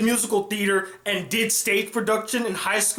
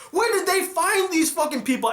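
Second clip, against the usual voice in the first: ordinary talk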